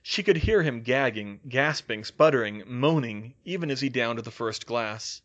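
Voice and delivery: usual speaking voice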